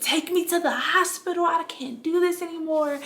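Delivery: high, strained begging from pain; desperate plea. Retold or imitated. To her midwives in the worst hour, a high desperate plea. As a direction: beg like you are at your limit. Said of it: "I literally said".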